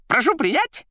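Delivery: high, loud, rattling patter; crackling chatter. A narrator's reading. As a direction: rattle it off like a jester. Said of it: "говорила трубка".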